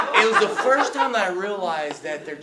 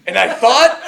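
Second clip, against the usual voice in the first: ordinary talk, nothing marked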